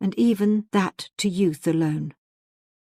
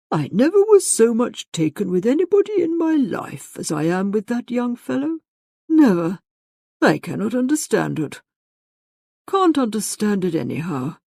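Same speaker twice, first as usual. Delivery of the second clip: animated father marvelling to himself, wide pitch swings; energetic self-talk; 5 semitones higher, 4 dB louder than usual. A narrator's reading. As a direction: start high and emphatic, then drop in bafflement. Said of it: "quite energetically to himself".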